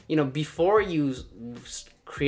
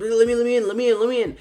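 high, loud, repeated plea to join; eager begging; 8 semitones higher, 7 dB louder, faster than usual. Retold or imitated. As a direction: imitate an over-keen client. Imitates client eager to join.

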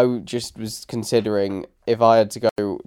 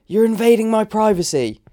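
high-pitched mock call from afar; distant shout; 10 semitones higher, 4 dB louder than usual. Drone pilot retelling a neighbour's complaint, distant voice. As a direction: imitate a woman calling out from a distance. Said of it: "like, a distant voice".

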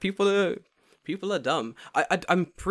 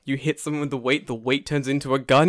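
usual speaking voice

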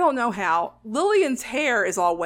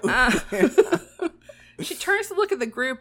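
laughing; 3 semitones higher, 4 dB louder, slower than usual. Real, not acted. Laughs while narrating.

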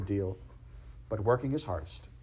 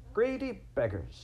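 a hint of a higher, slow otter voice; teasing otter; 11 semitones higher than usual. A narrator's reading. Otter finding the friends picnicking, lightly voiced teasing.

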